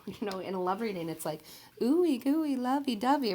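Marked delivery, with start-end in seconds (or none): 1.8-3.3 high, cooing sing-song on ooey gooey; teasingly gushing baby talk; mock lovey-dovey. Tarot reader mocking syrupy love talk, high cooing voice. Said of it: "I never talk like that".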